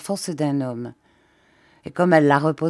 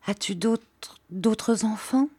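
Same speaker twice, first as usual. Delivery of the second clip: hesitant, higher anxious question; trembling with anguish; 4 semitones higher, slower than usual. A narrator's reading. Jeanne asking about other children, voice trembling. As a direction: falter on the repeated word, mildly anguished. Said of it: "une angoisse fit trembler sa voix".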